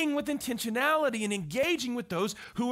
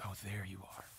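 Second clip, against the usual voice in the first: warm greeting; 13 semitones lower, 15 dB quieter than usual. Retold or imitated. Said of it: "looks at me and goes".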